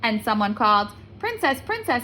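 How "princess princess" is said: sing-song call at the door; frog calling. A narrator's reading. Someone called.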